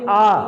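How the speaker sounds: आ held long and loud, twice the length of a short अ; a long, firm आ; long vowel. Shown as a demonstration. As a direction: stretch आ out clearly for the class. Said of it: "अ से ज़्यादा समय लग रहा है".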